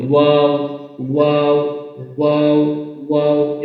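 ওয়াও with both lips fully rounded; letter articulation demo. Shown as a demonstration. Teacher drills learners, ওয়াও with rounded lips. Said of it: "এভাবে".

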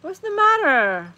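high sing-song question to a dog; pet talk. Real, not acted. Owner checks on whining dog, pet talk. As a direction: start high and slide down, as to a pet.

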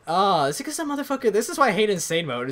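exasperated, fast-talking outburst; frustrated rant. Real, not acted. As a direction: blurt out the complaint in a rush. Player rants after losing in game.

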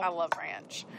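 soft, whispered confession; whispered aside. Real, not acted. Cook confiding her love of ranch, in a whispered aside. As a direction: confide it under your breath.

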